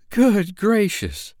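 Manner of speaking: high, slow, drawn-out gasp of alarm; startled exclamation. A narrator's reading. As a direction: clutch your pearls, way up high and slow. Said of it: "exclaimed Juno".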